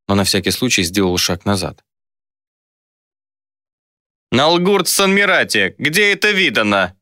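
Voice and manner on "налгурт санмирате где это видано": high-pitched, slow indignant protest; outraged young objector. A narrator's reading. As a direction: protest it in a raised pitch, dragging the first words. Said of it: "возмутился паренёк с шевелюрой".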